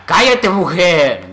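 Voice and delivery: loud, slow, high-pitched shout; exasperated yell. Pretend. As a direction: yell at a chattering character to shut up. Snaps at talking game character.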